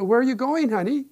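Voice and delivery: high, loud call out the window; wife shouting. Retold or imitated. Wife calls from the window, loud and high. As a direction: call out to him from the house. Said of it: "she shouts out the window".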